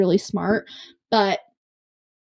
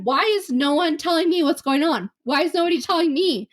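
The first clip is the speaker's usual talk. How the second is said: higher-pitched retold exasperation; mock-frustrated plea, pitched up; frustrated outburst; 7 semitones higher than usual. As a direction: re-enact a fed-up demand for answers. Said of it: "I got so frustrated".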